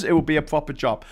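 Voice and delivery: ordinary talk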